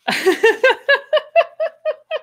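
high, bright disbelieving laugh; real burst of laughter. Real, not acted. Bikini pattern in underwear envelope.

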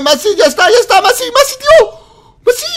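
high loud urgent calls; calling out. Real, not acted. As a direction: shout to get his attention.

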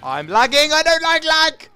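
rising to a high, loud whine-shout; raging. Real, not acted. Gamer at the lagging game, raging shout. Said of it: "I'm, I'm raging".

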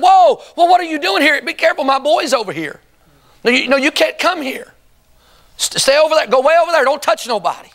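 startled warning to keep back; alarmed crowd. Acted. Crowd warning the unclean woman to stay away, alarmed. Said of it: "they would have said".

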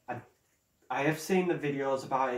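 usual speaking voice

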